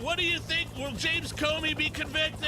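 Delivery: high-pitched shouted press question; hammed-up reporter hollering to be heard; mock reporter shouting. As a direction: yell it over a helicopter like a reporter. Nobody can hear anything.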